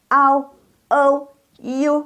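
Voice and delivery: Au, O, U as ao, ou, iu, high and level; model diphthongs. Shown as a demonstration. Teacher models the third group, high sustained glides. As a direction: draw out each glide on a high pitch. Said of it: "bagian ketiga".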